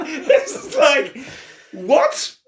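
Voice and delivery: high laughing disbelief; real laughter. Real, not acted. Host laughing at Jesus's understated greeting, amused disbelief. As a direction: laugh through it, slowly.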